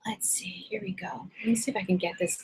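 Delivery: unvoiced whisper to herself. Real, not acted. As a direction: whisper it under your breath while fiddling. Muttering to herself at the computer.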